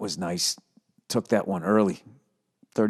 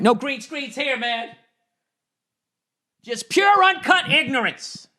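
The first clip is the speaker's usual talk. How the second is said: mock announcer; 14 semitones higher, slower than usual.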